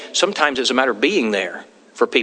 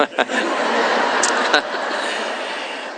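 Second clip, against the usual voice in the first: quiet laugh; 3 semitones lower, 3 dB quieter than usual. Real, not acted. Laughs at own joke.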